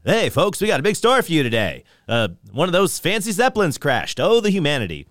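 fast, high 1930s newsreel announcer; hammy old-time radio newsman. Retold or imitated. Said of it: "more like an early nineteen thirties radio announcer".

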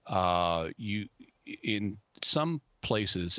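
ordinary delivery

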